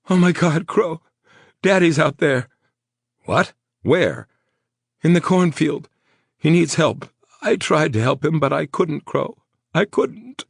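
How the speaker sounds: high, loud, panicked and breaking; hysterical rambling. Acted. Injured woman begging Crow to help her father, hysterical. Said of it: "She rambled, hysterical".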